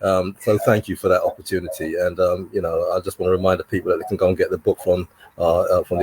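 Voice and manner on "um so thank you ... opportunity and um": normal talking voice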